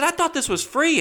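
very high mock protest; indignant protest. Comic replays objecting to the dancer's bill, high indignant voice. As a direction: squeak it out in hammed-up disbelief. Retold argument over the price.

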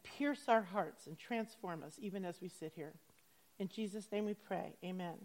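soft, humble public prayer. Acted. Preacher asking God for help to understand this passage, softly praying. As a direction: pray quietly, letting the voice sink towards the amen.

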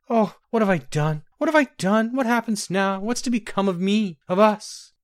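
high, rising anguished questions; queen wailing in despair over what she has done. A narrator's reading. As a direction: despair pouring out after the loss. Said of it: "she doubled over in despair".

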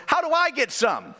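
put-on high, eager child's question; eager kid. Pastor voicing son Owen asking the lady. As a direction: ask it like a greedy little boy. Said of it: "And Owen goes".

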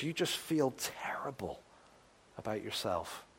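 hushed, unvoiced and soft; a whispered sorrow. Real, not acted. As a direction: breathe it out in shame. Whispered confession of guilt.

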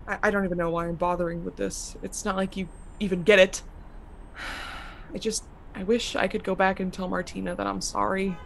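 soft, low, hopeless; quiet despair. Acted. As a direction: murmur regret softly.

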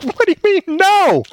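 put-on high, squeaky outraged child; child protesting stepdad's refusal, high and outraged; indignant kid. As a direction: protest like a wronged little boy.

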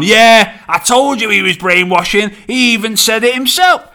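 a hint of an indignant partner; mock I-told-you-so. Voices listener's partner saying I told you.

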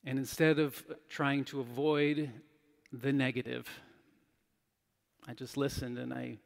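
usual speaking voice

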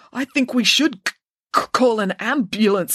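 high, halting stutter; stammering. A narrator's reading. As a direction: catch and repeat the first sound. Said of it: "stammered Joe".

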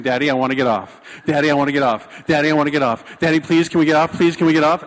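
loud, rapid-fire pleading, building; imitated panicked screaming. Daughter begging dad to get off, mock panicked yelling. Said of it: "she starts screaming".

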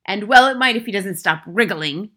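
loud, scornful retort; loud, unmoved headmistress; Trunchbull snort. A narrator's reading. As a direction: snort it back callously. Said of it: "snorted the Trunchbull".

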